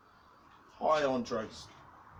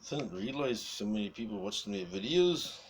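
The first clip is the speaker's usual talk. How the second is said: ordinary delivery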